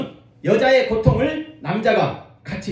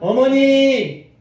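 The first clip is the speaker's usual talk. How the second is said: drawn-out, higher call of mother; 5 semitones higher, 4 dB louder, much slower than usual. Shown as a demonstration. Preacher leads the congregation in calling mother, drawn out. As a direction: call out to mother slowly with longing. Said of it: "우리 한번 어머니 한번 불러볼까요?".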